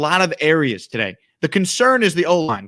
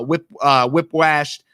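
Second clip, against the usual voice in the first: usual speaking voice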